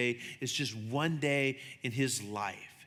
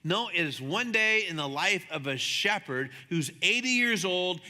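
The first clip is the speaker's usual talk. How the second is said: ordinary talk, nothing marked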